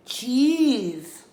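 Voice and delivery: high eager lamb cry. Acted. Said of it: "cried Borreguita".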